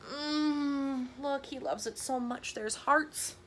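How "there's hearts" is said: usual speaking voice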